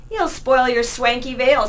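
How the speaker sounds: slow, loud and high leering taunt; leering thug teasing a passer-by. Acted. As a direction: draw it out like a lewd jeer. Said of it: "said Piccolo with a leer".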